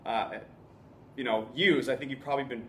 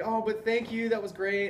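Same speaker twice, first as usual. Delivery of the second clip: high, light imitation of a pleased woman; lightly mocked feminine gratitude; delighted woman; 7 semitones higher than usual. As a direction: gush thanks in a put-on higher voice. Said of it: "delighted that this is happening".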